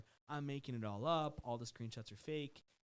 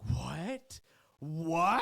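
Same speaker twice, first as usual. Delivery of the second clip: louder, rising shocked cry; shocked disbelief; 4 semitones higher, 10 dB louder than usual. Retold or imitated. Host hearing the girl's story, a loud shocked What. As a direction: gasp it out in disbelief.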